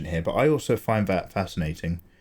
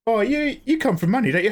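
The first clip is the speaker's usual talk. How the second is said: much higher, sing-song mocking accuser; mock needling accuser; 12 semitones higher than usual. Foreigner taunting an English person about money, in a much higher mock voice. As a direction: pitch it way up like someone needling.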